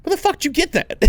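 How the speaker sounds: incredulous retort. Retold or imitated. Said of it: "I'm like".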